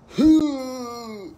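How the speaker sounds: high loud howl of pain; mock pained howl. Lily howling after hitting the pole hard. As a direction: howl like you just hit hard.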